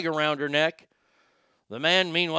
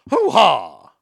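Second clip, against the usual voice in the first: much louder, barked Hoo-ha! in a put-on movie voice; Scent of a Woman impression; 10 dB louder than usual. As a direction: belt out the Hoo-ha! like the blind colonel from the film. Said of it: "as they would say in Sin of a Woman".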